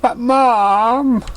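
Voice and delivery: high pleading "But, Mom"; whiny filly protest. Acted. Filly protesting to her mother about bedtime, whining. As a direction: whine like a kid sent to bed. Dinky whines.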